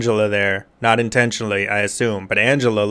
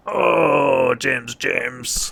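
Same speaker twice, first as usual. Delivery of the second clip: mock groan; 2 semitones higher, 4 dB quieter, much slower than usual. James asked about his girlfriend, fading mock groan. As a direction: imitate a pained, fading groan. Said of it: "And he's like".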